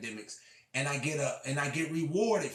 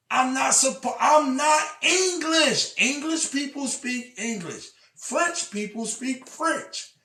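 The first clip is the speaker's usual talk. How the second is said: shouting; 10 semitones higher, 7 dB louder than usual. Real, not acted. Yelling at critics that he is not English, much louder and higher. Peak of angry rant.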